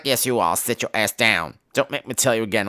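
low, quiet warning; stern nurse. Retold or imitated. Nurse warning Maggie to stay seated, stern. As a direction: lay down the law, low and soft.

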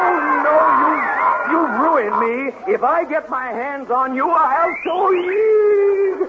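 high-pitched outraged wail building to a threat; angry threat. Acted. Ruined character threatens the culprit.

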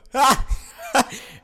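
high loud burst of laughter. Real, not acted. Mocking the bad porn video quality, high laugh. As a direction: let out a sudden high laugh.

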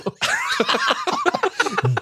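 high-pitched squeal of laughter. Real, not acted.

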